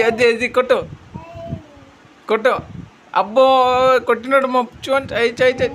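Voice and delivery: father playing with his little son. Real, not acted. Father talks to his small son.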